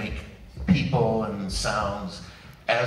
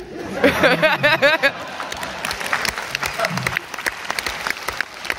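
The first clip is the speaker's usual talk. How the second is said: laugh; 3 dB louder than usual. Real, not acted. Laughing after the impression.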